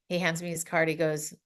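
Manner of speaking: ordinary delivery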